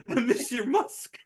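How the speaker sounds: high, drawn-out, laughing mock wail; badly faked heartbreak through laughter; mock breakdown. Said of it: "Champ starts breaking down".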